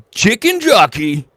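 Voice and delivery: loud, high, drawn-out cry; catchphrase shout. Pretend. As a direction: belt it out like a hype line.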